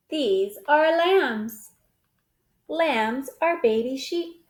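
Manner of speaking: ordinary delivery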